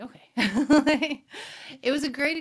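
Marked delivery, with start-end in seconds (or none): 0.0-1.2 loud, high laughing reply. Real, not acted. Mom giving in to her son's logic, laughing. 1.3-1.8 short trailing laugh. Real, not acted. Storyteller amused by her son's answer, laughing. Laughs after retelling the exchange.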